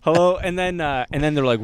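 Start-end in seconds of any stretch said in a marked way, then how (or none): 0.0-0.3 higher, louder rush of hellos; mock eager greeting. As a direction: overdo being a cooperative driver. Acts nervous greeting to the police.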